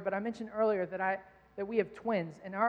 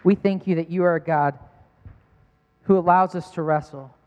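earnest louder prayer over the congregation; 7 dB louder than usual. Real, not acted. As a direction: pray over the room with conviction. Closing prayer.

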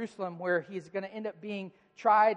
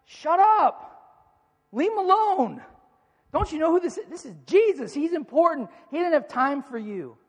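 much higher, louder scornful yell; crowd shouting down; 9 semitones higher, 8 dB louder than usual. Retold or imitated. The crowd yells at the two blind men, angry scornful shout. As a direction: slap them down with scorn. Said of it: "what the crowd says to him".

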